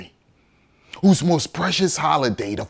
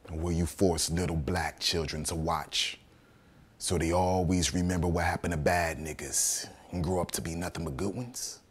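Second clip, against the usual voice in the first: low, hushed, pointed question; soft and low, with restrained bitterness; quiet accusation; 7 semitones lower, 12 dB quieter than usual. Acted. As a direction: press the charge softly, voice held down. Accusing the court, held back.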